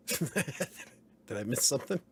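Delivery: slow, laughing puzzled question; laughing confusion. Real, not acted. Streamer laughing, lost in the chat thread. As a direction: chuckle through the confusion.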